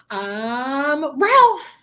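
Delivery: drawn-out lead-in, then a loud, high burst; excited spirit. Retold or imitated. Medium imitating how he comes through, excited burst. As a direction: burst out with the name. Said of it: "Just like that".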